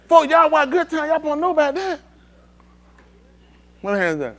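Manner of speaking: usual speaking voice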